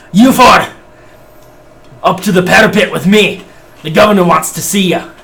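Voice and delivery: loud high Scottish-style dwarf; dwarf accent. Acted. Dwarf NPC summons the four to the parapet, loud dwarf accent. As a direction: bark the order in a dwarf brogue. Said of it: "I just broke my accent so bad".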